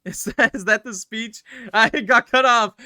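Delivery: laughing loudly, high and breathless; cracking up at being cut off by the game. Real, not acted. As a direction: burst out laughing mid-sentence. Laughing at the game's trick.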